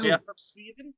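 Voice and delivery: high-pitched mock Swedish band member; mock metal band. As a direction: play along as a hammed-up metal musician.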